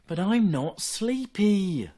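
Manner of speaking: high, slow stubborn whine; little fox. Acted. Little fox protesting to Mother Deer, high and slow. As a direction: insist like a tired cub. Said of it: "little Fox cried".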